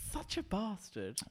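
camp character voice. Pretend. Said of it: "That was a character".

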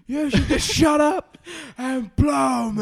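shouted crude rant with laugh; Mel Gibson rant. Retold or imitated. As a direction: bellow it out, laughing. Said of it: "I'm Mel Gibson".

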